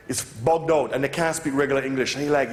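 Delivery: normal talking voice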